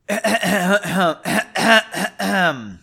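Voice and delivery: long, loud stuttering throat-clear; exaggerated awkward ahem; nervous throat clearing. A narrator's reading. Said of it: "he cleared his throat, apparently nervous".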